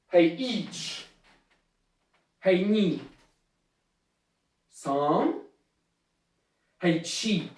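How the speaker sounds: low count with long gaps between numbers; measured martial arts count. Shown as a demonstration. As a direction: call each number, then wait before the next. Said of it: "Hey, un peu plus vite".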